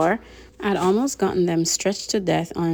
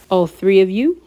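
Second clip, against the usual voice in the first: put-on louder British accent, rising doubtful question; Sharon; 8 dB louder than usual. A narrator's reading. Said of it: "He had a strange accent, British".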